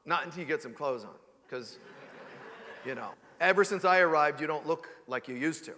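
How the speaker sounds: low, deadpan mock refusal; mock disapproving child. Child refusing Mom a hug, mock disapproving and deadpan. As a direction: turn down the hug in a dry, low voice. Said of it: "They don't say".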